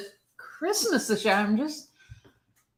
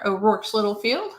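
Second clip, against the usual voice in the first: normal talking voice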